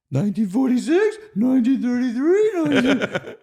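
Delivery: very high, loud cartoon voice, dragged slowly at the end; Snooki impression. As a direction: squeak it out like the puppet. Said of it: "the inflection's excellent".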